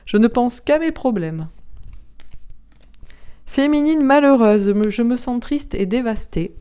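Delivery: ordinary talk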